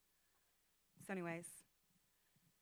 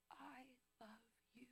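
tender whisper; 5 semitones higher, 25 dB quieter than usual. Retold or imitated. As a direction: breathe it like a loving secret. God's letter read tenderly.

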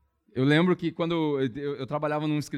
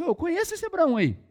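high-pitched excited exclamation of recognition; 13 semitones higher, 4 dB louder than usual. Retold or imitated. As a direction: imitate her delighted discovery, voice jumping up high. Acts out woman recognizing Abraham.

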